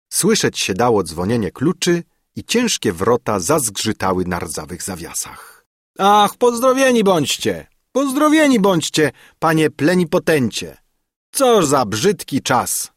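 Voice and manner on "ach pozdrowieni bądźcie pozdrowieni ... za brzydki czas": put-on high, loud and eager welcome from the old servant; an old servant gushing a welcome; effusive greeting. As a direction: greet the master with a fussy joy.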